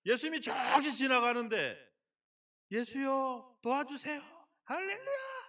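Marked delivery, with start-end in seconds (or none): 2.7-5.4 mild polite plea. Pretend. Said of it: "이러면 들-, 와요?".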